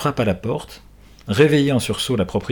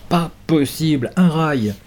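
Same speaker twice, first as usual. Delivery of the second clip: relieved surprise; 6 semitones higher, slower than usual. Acted. Boy finds the rail in fog.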